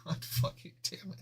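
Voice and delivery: breathy whisper of defeat; a defeated whisper at the screen; whispered curse. Real, not acted. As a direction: hiss it under your breath.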